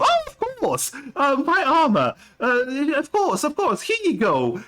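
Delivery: very high, eager put-on voice; hammed-up, high-pitched mock obliging reply; Gala impression. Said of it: "that was my, my Gala impression".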